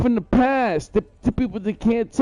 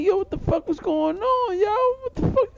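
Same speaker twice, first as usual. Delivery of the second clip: much higher, shocked disbelief; incredulous outburst; 12 semitones higher than usual. Retold or imitated. He retells his own shocked reaction, pitched way up. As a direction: squeal it out like you can't believe it. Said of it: "You know, I'm like".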